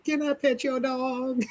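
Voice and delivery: very high, drawn-out mocking squeak; exaggerated high-pitched mimicry, slow and soft; mock pleading stranger. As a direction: stretch it out slowly in a silly high voice. Repeats the mock dog-petter, drawn out.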